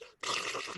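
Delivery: voiceless lip-flutter sound; flapping-face noise. Retold or imitated. Jumper shows wind hitting the face, flapping-face noise. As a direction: imitate cheeks flapping in rushing air.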